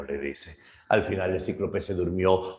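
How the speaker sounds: normal talking voice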